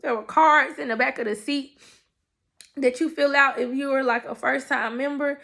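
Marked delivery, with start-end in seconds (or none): none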